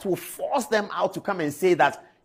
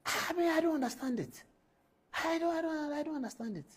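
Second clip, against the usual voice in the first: high, soft, helpless bafflement; exasperated disbelief; 11 semitones higher, 9 dB quieter than usual. Real, not acted. Host baffled by a journalist's insults on TV, exasperated disbelief. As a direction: let the voice go thin and high, at a loss.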